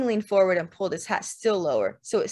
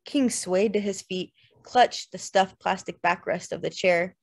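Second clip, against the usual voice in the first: ordinary delivery, nothing marked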